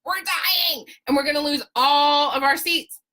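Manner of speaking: high, hissing creature whine for my precious; a quick Gollum impression, high on precious. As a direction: do a Gollum clinging to the edge. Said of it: "like Gollum hanging on the edge".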